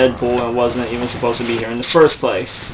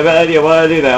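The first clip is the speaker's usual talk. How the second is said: panicked, high and loud; frantic realising the mistake; terrified horror victim; 4 semitones higher, 6 dB louder, much faster than usual. Real, not acted. Panic, repeated.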